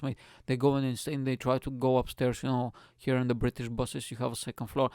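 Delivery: ordinary talk, nothing marked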